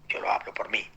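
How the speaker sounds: whispered personal confession; hushed repeat. Real, not acted. Preacher confessing to the congregation, repeating it in a whisper. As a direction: say it again softly, almost to yourself.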